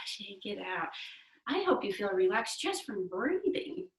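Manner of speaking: ordinary talk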